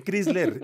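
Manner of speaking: "Chrysler" as CRÍS-ler, with a short i; Spanish-style Chrysler. Retold or imitated. Panelist quoting Spaniards, "Chrysler" as CRÍS-ler. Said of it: "Y dicen Chrysler".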